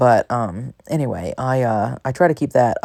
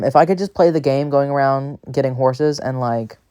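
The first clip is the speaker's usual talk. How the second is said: ordinary delivery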